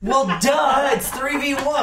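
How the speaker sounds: high, loud sarcastic duh; mocking duh. Real, not acted. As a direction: say it like it's obvious. Mocking the obvious.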